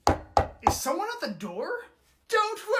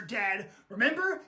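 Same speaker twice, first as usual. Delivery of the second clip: ordinary delivery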